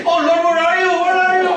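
high, loud, panicky wail to God; mock panicked cry. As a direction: cry out like the plane is going down. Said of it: "And then the plane crashes".

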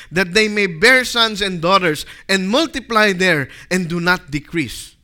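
loud, forceful Bible reading; scripture reading. Acted. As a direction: proclaim the scripture to the congregation. Reads scripture at full force.

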